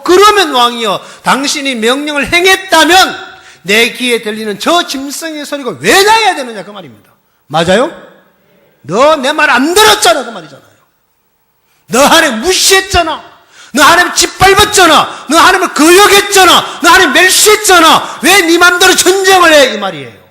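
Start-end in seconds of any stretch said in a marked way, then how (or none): 0.0-6.6 loud, higher, pointed challenge; Samuel's challenge. Acted. Preacher voicing Samuel confronting Saul, loud and high. 8.8-10.2 loud, higher accusing rebuke; stern rebuke. Acted. Samuel rebuking disobedient Saul, loud and stern. 11.9-19.7 loud, much higher shouting rebuke; shouted accusation. Acted. Samuel shouting charges at Saul, furious.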